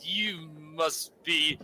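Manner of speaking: mumbled slow. Acted. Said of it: "I mumble".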